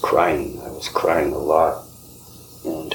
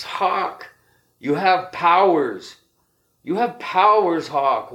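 voicing the elder; 13 semitones higher than usual. The elder telling Hawk he wants to go home, high and pleading.

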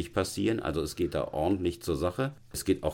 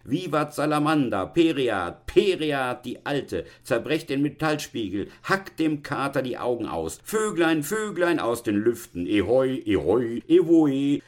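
put-on high, jubilant drunken chant; shouting wildly; 8 semitones higher, 3 dB louder than usual. Three possessed drinkers smashing glasses, crying out wildly. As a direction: whoop out frenzied toasts and curses. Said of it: "So schrien und brüllten die drei Besessenen".

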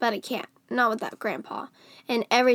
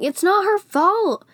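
high, loud, stretched-out shout; yelling; 9 semitones higher, 7 dB louder, slower than usual. A narrator's reading. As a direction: yell it out in her defence. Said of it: "still running and yelling".

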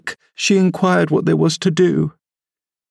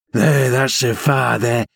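gleeful cry. A narrator's reading. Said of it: "he cried".

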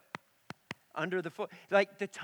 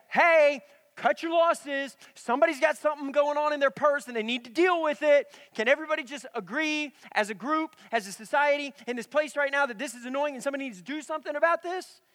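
higher, louder mock yell at the whole store; fed-up mock announcement yelled across a store; retold yell; 7 semitones higher, 6 dB louder than usual. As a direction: act out the urge to shout to everyone, loud and high. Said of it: "I'm about to yell in the middle of a store".

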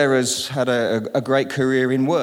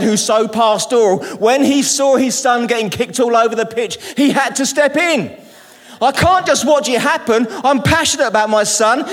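preaching loud; 8 semitones higher, 5 dB louder than usual. Real, not acted. Preaching climax.

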